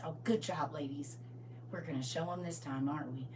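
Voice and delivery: gentle encouragement. Acted. Babe praising the sheep.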